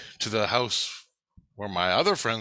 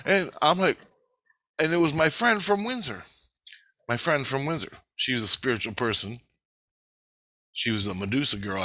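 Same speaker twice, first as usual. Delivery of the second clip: ordinary delivery, nothing marked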